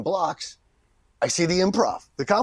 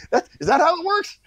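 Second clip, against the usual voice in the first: very high, laughing question; amused disbelief; 14 semitones higher, 3 dB louder than usual. Retold or imitated. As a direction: squeal it in mock amazement. Acts amazed at instant success, laughing.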